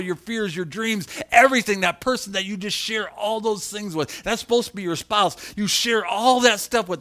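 loud, high, fervent preaching with laughs; full-force preaching. Acted. Pastor preaching to his congregation, loud and fervent. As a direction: preach it out to the whole room.

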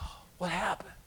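hushed, awed whisper; mock-awed whisper; amazed whisper.

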